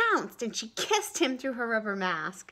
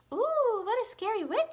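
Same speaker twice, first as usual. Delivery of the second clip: absent-minded; 14 semitones higher, 6 dB louder than usual. Acted. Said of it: "rather absent-mindedly".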